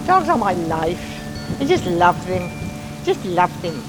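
ordinary talk

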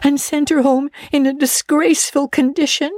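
put-on a breathless young girl confiding; Anne's girlish voice. Girl confiding a mortifying dinner to her friend, eager. Anne tearful report.

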